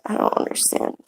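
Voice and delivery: very soft, nearly whispered; hushed aside. Real, not acted. Mother to small child mid-video, hushed. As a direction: drop to a breath of a voice, puzzled.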